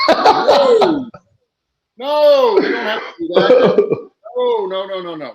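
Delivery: high, loud, flustered laughing no, no; laughing protest. Retold or imitated. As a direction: squeal out the refusal through laughter. Flustered refusing Macho Man watching.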